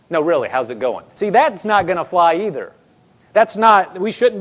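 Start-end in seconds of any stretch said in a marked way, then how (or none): 0.1-1.1 low, confidential doubting question; skeptical probing. Retold or imitated. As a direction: imitate a friend not buying the answer. Acting out friend who doubts answer.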